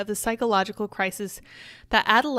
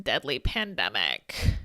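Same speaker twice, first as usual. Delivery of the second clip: lizard person impression; 10 semitones lower, slower than usual. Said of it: "That's my impression of an actual lizard person".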